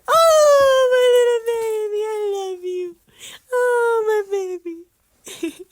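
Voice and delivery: high, tearful gushing. Real, not acted. Owner tearfully cooing to her beloved dog. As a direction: choke up while cooing to the dog.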